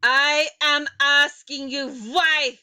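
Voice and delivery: loud, slow, high witch scream. A narrator's reading. Grand High Witch screaming at the silent audience. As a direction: hammer the why like a furious witch. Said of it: "she screamed".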